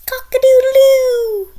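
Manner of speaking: loud, high, drawn-out rooster crow; hammed-up cock-a-doodle-doo. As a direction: crow like a rooster to wake the house. Morning rooster call.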